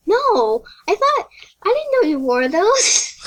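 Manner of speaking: high-pitched retold disbelief; surprised friend. Friend learning she wore implants, surprised. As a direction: imitate an astonished schoolmate, voice lifted high.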